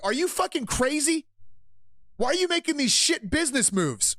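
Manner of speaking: high, incredulous outrage; mock outraged shareholders. Streamer mocking shareholders in high outraged voice. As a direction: play the furious investor. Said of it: "the other shareholders are like".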